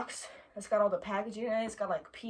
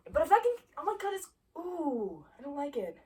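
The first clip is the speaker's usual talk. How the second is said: scared; 6 semitones higher than usual. Real, not acted. Bug about to fly.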